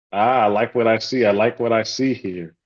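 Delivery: ordinary talk, nothing marked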